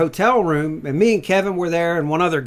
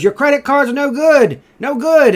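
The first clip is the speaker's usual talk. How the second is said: mock broken-English lady; 11 semitones higher, 9 dB louder than usual. Motel lady scolding a hungover guest, mock accented. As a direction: jab each "no good" like an angry motel clerk.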